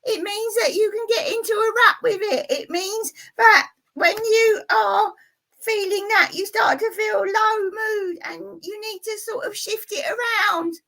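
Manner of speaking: very high, squeaky puppet character lecturing. Acted. Puppet explains negative thinking to the viewers, very high squeaky voice. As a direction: pitch way up for the little puppet. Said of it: "it's a puppet show".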